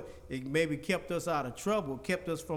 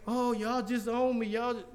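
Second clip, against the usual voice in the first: higher-pitched mock protest at being rebuked; mock fainting complaint; 6 semitones higher than usual. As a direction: play someone wilting under a rebuke. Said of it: "Sometimes we faint".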